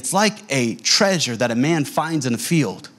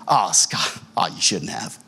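touched gift receiver; 11 semitones lower, slower than usual. Acted. Told he is getting a gift from his friend, touched. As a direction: drop low as if humbly touched. Feigned thanks for promised gift.

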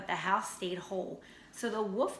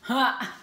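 triumphant ha; 4 semitones higher, 7 dB louder than usual. Acted. Triumphant laugh.

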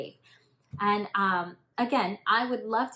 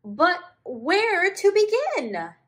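very high, slow, timid little voice; shy tamarin; 12 semitones higher, 9 dB louder than usual. Acted. Shy tamarin asking the group, high timid voice. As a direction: ask it like a bashful tiny monkey. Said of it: "asked the shy tamarin".

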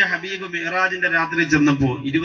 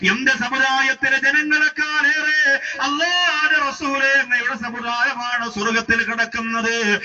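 intoned preaching with long-held line endings; impassioned religious storytelling; full-force sermon; 6 semitones higher, 3 dB louder than usual. Real, not acted. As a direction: preach it in a sustained, ringing chant. Preaches and exhorts the congregation.

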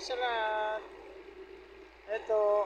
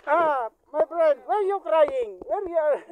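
raised, louder playful broken-English teasing; mock teasing; 7 semitones higher, 11 dB louder than usual. Teasing a crying friend, playful and louder. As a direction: poke fun at a friend, a notch higher and louder.

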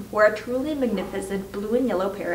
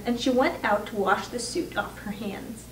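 ordinary delivery

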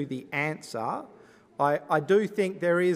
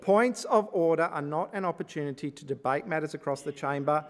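ordinary delivery

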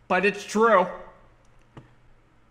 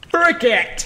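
loud, high outburst; exasperated yell; 13 semitones higher, 10 dB louder than usual. Real, not acted. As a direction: shout it in sudden frustration. Something breaks in game.

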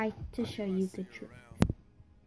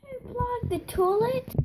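high accusing question; 11 semitones higher than usual. Acted. Parent asking.

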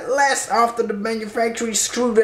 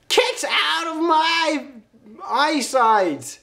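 annoyed shout; 8 semitones higher, slower than usual. Real, not acted. Annoyed at crowds outside.

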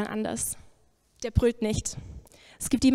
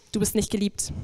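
usual speaking voice

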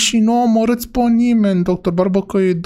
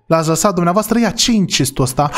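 ordinary talk

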